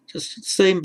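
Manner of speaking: usual speaking voice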